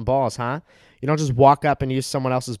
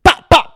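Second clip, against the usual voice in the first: much higher, louder gun blasts from the mouth; mock gunshots; 16 dB louder than usual. Acting out shooting the dad, mouth gunshots. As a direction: bark out fake gunfire.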